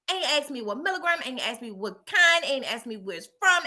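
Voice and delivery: ordinary talk, nothing marked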